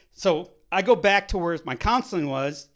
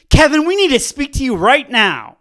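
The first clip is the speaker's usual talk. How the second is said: stern director voicemail; 5 semitones higher, 14 dB louder than usual. Retold or imitated.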